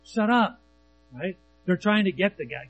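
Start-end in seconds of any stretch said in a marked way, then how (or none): 0.0-0.6 high, curt silencing; raised-pitch mock rebuke; crowd's rebuke. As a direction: shush someone as an annoyed crowd would. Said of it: "what do the crowds do?".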